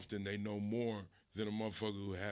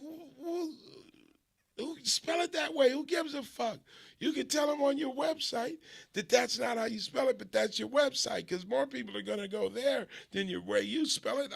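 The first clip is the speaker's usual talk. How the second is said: much higher, louder dismissive rant; exasperated cousin; 12 semitones higher, 6 dB louder than usual. Retold or imitated. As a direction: wave the objection away impatiently. Cousin brushing off spelling complaint.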